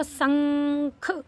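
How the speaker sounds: शंख with a long held nasal hum, then a separate ख; drawn-out anusvara. Shown as a demonstration. Teacher showing children how शंख is read, drawn-out anusvara. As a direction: stretch the nasal in शंख slowly. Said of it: "इस तरीके से बोलेंगे".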